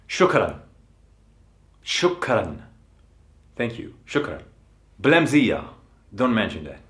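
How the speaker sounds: ordinary delivery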